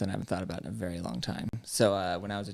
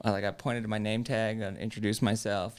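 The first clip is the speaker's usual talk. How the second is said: ordinary delivery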